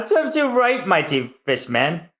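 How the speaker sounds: high mocking laugh into a sneer; villain gloating over Tom. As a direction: cackle at the fallen fishman. Spandam gloating.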